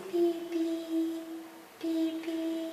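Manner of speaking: high car-horn beeps; playful horn beeps for the class. Shown as a demonstration. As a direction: toot it like a little car horn. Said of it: "we are going to do this".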